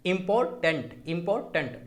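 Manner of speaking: "Important" split as इंपॉर-टेंट; pause inside word. Shown as a demonstration. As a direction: repeat "important" with a minor gap before tent. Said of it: "बीच में थोड़ा सा".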